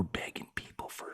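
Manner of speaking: hushed whisper; breathy, toneless whisper. Real, not acted. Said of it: "Why are you whispering".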